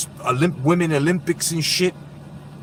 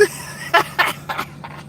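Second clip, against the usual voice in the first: breathless wheezing laugh; 13 semitones higher, 4 dB quieter than usual. Real, not acted.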